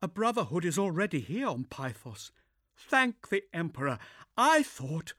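composed but shaken inquisitor; young woman voice. Acted. Zula relieved.

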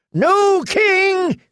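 extremely high, ringing last cry; cried out. A narrator's reading. Dying knight cries out before the sword, very high cry. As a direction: hurl it out as he dies. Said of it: "he cried again".

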